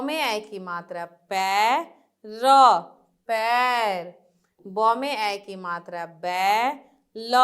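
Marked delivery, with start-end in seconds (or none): none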